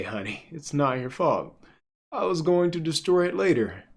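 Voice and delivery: ordinary talk, nothing marked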